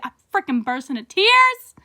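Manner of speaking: usual speaking voice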